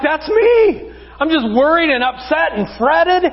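high-pitched mock fretting; freaking out. Worried listener owning up to the verse, mock freak-out. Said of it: "freaking out".

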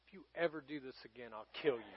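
very soft, low warning; hushed, low-pitched parental threat; quiet threat. Retold or imitated. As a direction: deliver it under the breath, dead serious. Retold stern threat to found daughter.

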